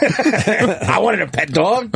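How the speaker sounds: high, slow, pleading kangaroo voice; whiny kangaroo excuse. Pretend. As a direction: drag it out like a caught pet thief.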